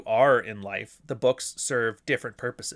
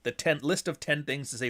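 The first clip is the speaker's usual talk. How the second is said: usual speaking voice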